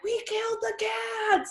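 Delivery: sudden high panicked cry; mock-frantic high wail; retold panic. Telling her friend about the tripping cats, high panicked cry.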